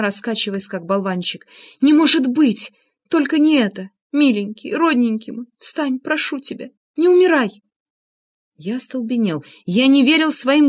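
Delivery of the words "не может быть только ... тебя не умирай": high, grieving plea; anguished moaning. A narrator's reading. Анна pleading with dying Влад, anguished moaning. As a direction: beg the dead man to come back. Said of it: "стонала она".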